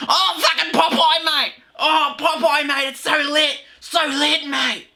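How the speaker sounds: high-pitched mock excited mate; really excited. Host impersonating school mate on Popeye cartoons, excitedly. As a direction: imitate a hyped friend, voice shooting way up. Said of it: "he'd get really excited".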